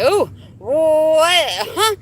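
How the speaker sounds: high, startled confused squeal; acting out total bewilderment; baffled. Confused first time seeing coke.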